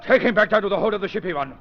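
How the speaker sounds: villain commanding his servant to take the prisoner away; barked order. Acted.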